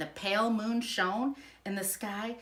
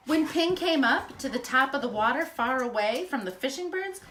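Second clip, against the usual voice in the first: usual speaking voice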